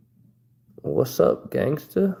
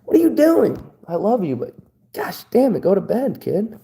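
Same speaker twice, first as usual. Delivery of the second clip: mock scolding mom; 7 semitones higher than usual. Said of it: "She'll be like".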